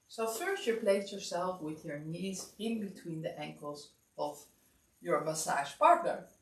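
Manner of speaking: ordinary talk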